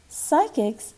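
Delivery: ordinary delivery, nothing marked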